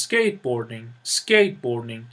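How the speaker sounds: Skateboarding with skate stressed, board light; stress on first part. Shown as a demonstration. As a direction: hit skate, let boarding fall away. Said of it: "the stress is on the first syllable".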